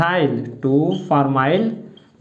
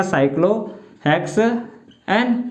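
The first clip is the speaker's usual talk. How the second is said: very slow, with gaps inside cyclohexane; teacher spelling out cyclohexane by sound; syllable-by-syllable dictation; much slower than usual. Shown as a demonstration. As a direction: draw out cyclohexane slowly in separate pieces. Teacher dictates the term in pieces.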